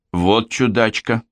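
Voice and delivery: ordinary delivery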